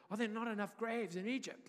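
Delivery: high, loud sarcastic jab at Moses; classic sarcasm. Retold or imitated. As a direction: sneer it like a bitter complaint. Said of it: "it's classic sarcasm".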